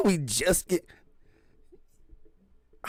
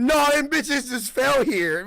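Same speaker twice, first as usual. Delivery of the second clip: very slow mock answer; much slower than usual. Slower echo of the answer.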